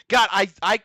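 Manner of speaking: normal talking voice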